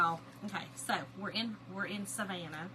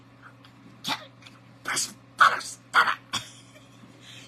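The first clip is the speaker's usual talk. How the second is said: voiceless, choking bursts of laughter; hard laughing; 6 dB louder than usual. Retold or imitated. Imitating her cousin laughing so hard, wheezing. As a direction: laugh till only wheezes come out. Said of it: "She was laughing so hard".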